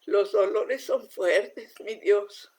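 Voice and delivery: slow, pained plea to God; retold crying prayer. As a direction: plead as if through tears, slowly. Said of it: "Yo lloraba y decía". (súplica dolida y lenta, como llorando)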